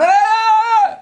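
very high, loud stream of nonsense yelling; garbled mock shouting. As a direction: imitate a shout lost in the wind. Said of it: "וצועק לו משהו לחלון". (צעקה לא מובנת בחיקוי)

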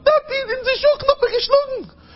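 put-on very high-pitched child complaining to Daddy; little boy's voice. Boy comes crying to his Tate, squealed child's voice. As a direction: squeal it like a kid who got hit. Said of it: "der Engel kommt zu seinem Taten".

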